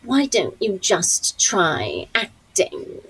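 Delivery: very slow, withering British dame; Maggie Smith. Retold or imitated. Veteran co-star advising breathless American actress, slow withering impersonation. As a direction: draw out each word with grand English disdain. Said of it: "that's the best Maggie Smith".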